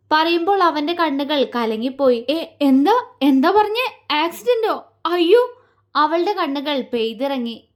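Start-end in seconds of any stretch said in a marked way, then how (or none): 2.3-5.6 gasping out shocked questions; shocked alarm. A narrator's reading. Paaru alarmed at news of his accident, higher.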